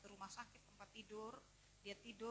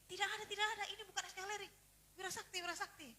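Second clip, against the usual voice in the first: high, loud correction; emphatic denial; 8 semitones higher, 6 dB louder, faster than usual. Retold or imitated. Hospital staff denying the room is here, high and emphatic. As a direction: rebuff the visitor insistently. Acted brusque reply at hospital.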